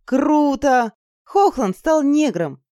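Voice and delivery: high, drawn-out exclamation; ecstatic yell. Acted. Girl thrilled by the transformation, ecstatic. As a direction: whoop with delight. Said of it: "в восторге завопила я".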